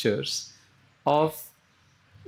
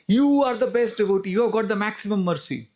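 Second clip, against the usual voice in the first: raised-pitch, admiring acclaim; excited praise; 7 semitones higher than usual. Retold or imitated. Narad Muni hails a worshipping Brahmana, excited praise. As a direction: open high on YOU and pour out praise.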